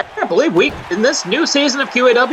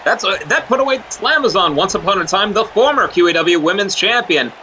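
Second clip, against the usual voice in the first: ringside play-by-play; wrestling commentator. Acted. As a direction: call the action like a wrestling broadcast.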